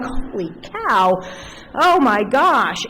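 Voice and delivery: hammed-up dread, drawn out; mock panicked gasp. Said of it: "I'm like".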